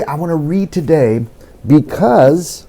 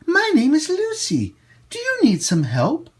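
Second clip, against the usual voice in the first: higher, a hint of a small girl; little girl Lucy; 12 semitones higher than usual. Lucy asks the man, lightly voiced young girl. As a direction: offer help like an eager little girl. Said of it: "she said".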